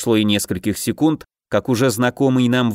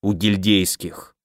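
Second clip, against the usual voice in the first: sour grimace; 4 semitones lower, slower than usual. A narrator's reading. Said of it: "капитан скривился, словно от зубной боли".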